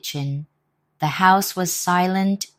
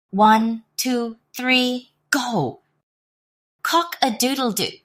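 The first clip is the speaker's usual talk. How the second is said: rally the animals to charge; rousing count; 7 semitones higher than usual. Acted. Animals' attack signal.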